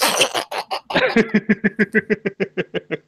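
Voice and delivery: long, high run of laughter; drawn-out high cackling laugh; real hearty laugh. Real, not acted. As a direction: crack up at your own story. Laughs at own anecdote.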